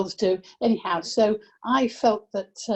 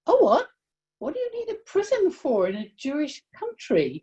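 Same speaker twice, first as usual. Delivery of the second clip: mother's innocent surprise at a prison; astonished; 7 semitones higher, faster than usual. Retold or imitated. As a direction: voice the mother's naive shock, then settle. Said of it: "turned around and said".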